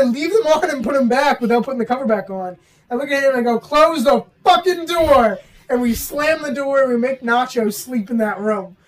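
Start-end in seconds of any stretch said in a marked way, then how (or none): 3.6-5.4 urgent yell. Retold or imitated. Panic at haunted candles.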